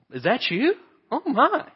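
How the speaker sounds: high, rising astonished voice; playfully exaggerated surprise; mock amazement. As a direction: act out stunned recognition, pitch shooting up. Acts out people's amazed reaction.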